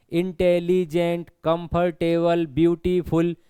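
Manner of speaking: Intelligent, comfortable, beautiful chopped into distinct syllables; broken into syllables. Shown as a demonstration. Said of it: "इसको हमें तोड़कर बोलना पड़ रहा है".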